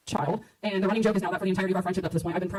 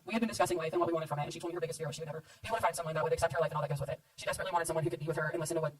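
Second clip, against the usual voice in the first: wedding toast speech; 2 semitones lower, 3 dB quieter than usual. Acted. Reads her toast.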